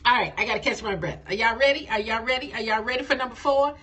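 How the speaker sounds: ordinary delivery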